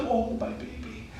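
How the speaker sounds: high-pitched cooing through laughter; doting mother. Retold or imitated. Yashoda comforts crying Krishna, playfully cooing. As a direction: gush like a mother over her baby. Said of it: "She's like".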